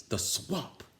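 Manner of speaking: high, hushed gasp of dread. Acted. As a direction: breathe it out in horror. Kids gasping in dread.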